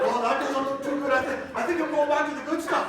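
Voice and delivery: nervous giggly retreat; laughing nervous aside. Retold or imitated. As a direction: back away with a laugh. Backing away from Kenny.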